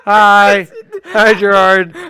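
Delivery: high, loud hello through laughter; laughing greeting. Pretend. Co-host greeting the mocked coworker on stream, laughing. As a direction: call out a teasing hello while cracking up.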